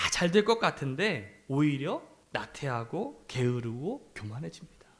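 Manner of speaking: ordinary talk, nothing marked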